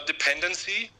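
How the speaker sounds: ordinary talk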